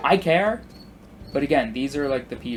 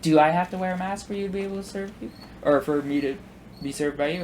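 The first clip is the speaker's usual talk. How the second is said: imitating a smug customer, pitched up; mock smug; 5 semitones higher than usual. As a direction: ask it with exaggerated smug innocence. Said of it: "looks at me really smugly and goes".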